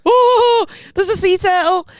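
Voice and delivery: very high, loud excited squeal; thrilled squeal. Retold or imitated. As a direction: squeal like a kid spotting a turtle. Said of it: "that was the audio that was playing in my head".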